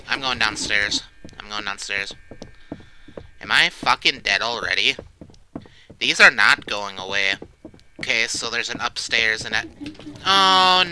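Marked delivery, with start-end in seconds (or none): none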